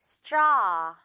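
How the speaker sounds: straw said with a wide open ah; model straw. Shown as a demonstration. Teacher modeling straw for students to repeat. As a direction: say straw clearly with the mouth wide. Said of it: "아 발음 갈게요".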